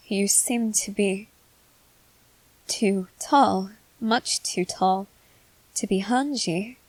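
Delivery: halting, drawn-out words with long pauses; slow, hesitant realization. Acted. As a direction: feel your way through a dawning, uneasy realization. Realizing the stranger isn't Hanji.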